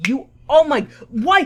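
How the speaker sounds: usual speaking voice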